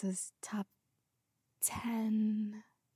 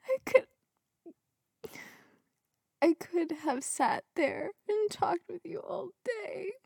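about to cry; 9 semitones higher than usual. Real, not acted. Reader choking up over the widower's love letter. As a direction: voice breaking, high and slow. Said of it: "Yo, I'm about to cry".

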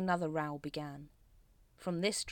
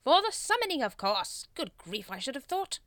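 high, loud impatient reply; exasperated leader's retort; irritated; 9 semitones higher, 7 dB louder than usual. A narrator's reading. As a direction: answer as if it were obvious. Grand Master irritated by question.

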